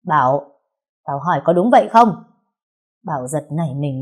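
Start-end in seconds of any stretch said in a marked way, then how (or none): 0.1-2.1 loud, sharp demand for an answer; angry snap. A narrator's reading. Older sister yelling at her silent brother for the truth. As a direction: bark the name, then press the question. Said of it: "liền quát".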